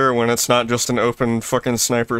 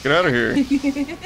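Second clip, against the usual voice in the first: silly high voice; 13 semitones higher, slower than usual. Pretend. Joking put-on voice.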